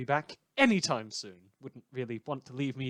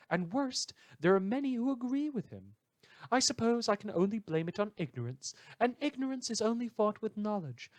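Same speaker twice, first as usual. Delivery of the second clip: higher voice for the indignant letter writer; reading a letter aloud; 7 semitones higher than usual. Acted. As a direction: voice the outraged fan who wrote in, pitched up. Host reads listener's letter aloud.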